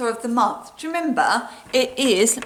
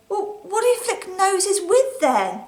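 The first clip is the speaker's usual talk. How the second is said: cheeky question; 8 semitones higher than usual. Acted. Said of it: "And I say".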